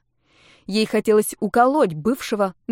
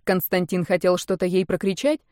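ordinary delivery

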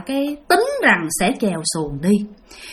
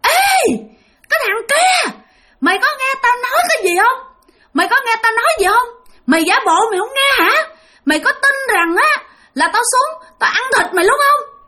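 very high, menacing shriek of a ghost; shrill ghost woman; 11 semitones higher than usual. Acted. Ghost woman threatening the fisherman who ignores her, shrill and menacing. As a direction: threaten in a piercing, eerie woman's voice. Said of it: "một cái giọng bà the thé rất là ghê".

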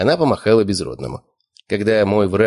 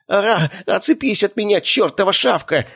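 high-pitched panicked squeal; squealing in pain; 12 semitones higher than usual. A narrator's reading. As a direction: squeal as if something is biting you. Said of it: "заверещал от боли Безродный".

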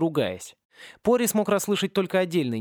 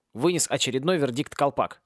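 usual speaking voice

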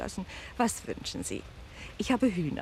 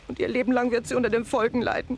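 angry; 2 semitones higher, 5 dB louder, faster than usual. Acted. Landlady rails at the guests.

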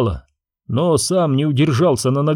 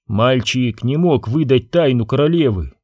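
plain reading voice

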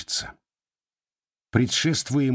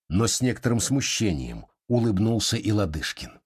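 ordinary delivery

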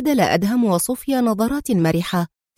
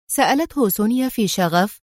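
plain reading voice

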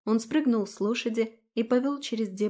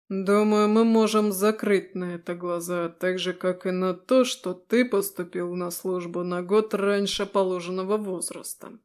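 steady, level officer voice; calm and firm, no threat shown; even commander voice; 2 semitones lower, 3 dB louder than usual. Acted. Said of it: "Голос мужчины был ровным".

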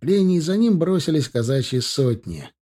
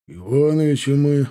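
ordinary delivery

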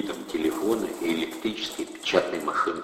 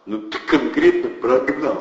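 loud, higher gangster scoff; indignant thug; 8 semitones higher, 6 dB louder than usual. A narrator's reading. Thug scoffs at Eddie, indignant and loud. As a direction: scoff at the nonsense. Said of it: "возмутился Протасов".

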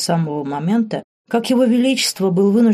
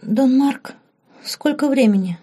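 higher, lightly voiced girl waking up; just-woken girl; 7 semitones higher than usual. A narrator's reading. Lauren wakes and asks Mark the time, high sleepy girl's voice. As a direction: ask in a high voice, fresh from sleep. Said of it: "чуть затуманенным после сна взглядом".

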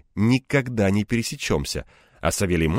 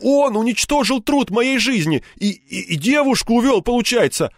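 high, stammering outrage; outraged, raised-pitch tirade; breathless indignation; 13 semitones higher, 4 dB louder than usual. A narrator's reading. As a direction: splutter the accusation, short of breath. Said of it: "в приливе возмущения ему трудно было дышать".

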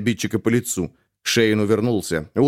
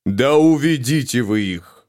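usual speaking voice

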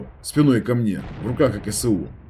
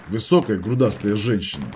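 ordinary delivery

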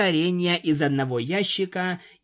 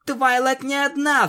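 sudden cry; 9 semitones higher than usual. A narrator's reading. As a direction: blurt it out desperately. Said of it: "вскрикнула Пегаска".

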